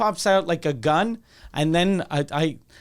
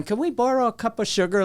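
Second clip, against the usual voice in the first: put-on higher, old-timey country accent; 5 semitones higher, faster than usual. Neighbor kid borrowing sugar, in an old-timey country accent. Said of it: "Like, very country, old-timey".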